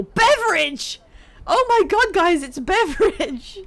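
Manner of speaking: high, loud fan excitement; excited shout. Real, not acted. Streamer spotting Beverage in the game, excited shout. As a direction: cry out at spotting a favourite.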